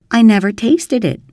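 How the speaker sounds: an insistent, innocent denial; protesting girl. A narrator's reading. Anne protests to Marilla that she never tasted it.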